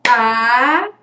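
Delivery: "A" held long as one separate sound; sílaba isolada. Shown as a demonstration. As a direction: sound out "A" slowly, on its own. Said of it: "olha como eu digo". ("A" bem alongado, um som só)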